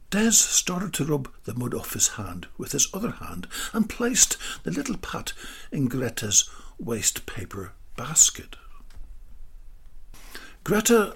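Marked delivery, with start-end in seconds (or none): none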